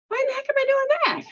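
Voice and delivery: high, fast, bewildered squeal of frustration; baffled outburst. Retold or imitated. Someone confused by their own healing work, high fast squeal. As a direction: squeak it out like you can't believe yourself. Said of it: "you'll be like".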